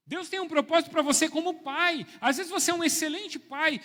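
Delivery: loud, high, driving preacher's voice; preaching at full force. Acted. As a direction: preach it out to the whole church. (pregação inflamada, em voz alta e aguda)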